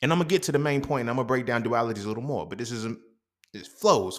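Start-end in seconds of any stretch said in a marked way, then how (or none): none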